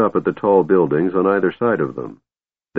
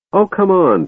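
much higher, eager pleading; coaxing; 11 semitones higher, 3 dB louder than usual. Acted. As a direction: wheedle the dwarf along. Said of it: "Tass said".

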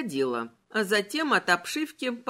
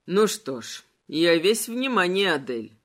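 skeleton voice; 4 semitones lower than usual. Acted. Malachi voiced. (низкий властный голос короля)

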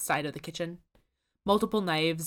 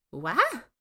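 higher, louder baffled what; baffled Susan; 6 semitones higher, 5 dB louder than usual. A narrator's reading. Susan fails to follow the French, baffled. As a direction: ask it completely lost. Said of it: "asks Susan".

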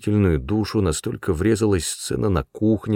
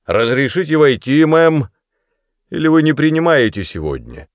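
loud, higher, pompous mock courtesy; mock-grand shout; 6 semitones higher, 6 dB louder than usual. A narrator's reading. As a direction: call out with jokey grandeur. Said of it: "с шутливой важностью прокричал". (шутливо-важный выкрик)

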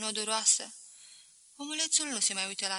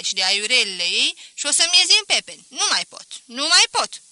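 exasperated merchant; 8 dB louder than usual. Acted. Merchant complains his wife has everything, exasperated and loud. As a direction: pile up the complaints, getting more worked up.

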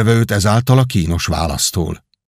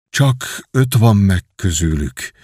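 usual speaking voice

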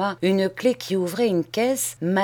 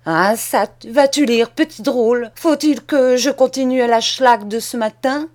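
loud, raised threat; angry threat; 5 semitones higher, 6 dB louder than usual. Acted. Cousin threatens silent Charles with another beating, loud. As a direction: threaten him with the whip.

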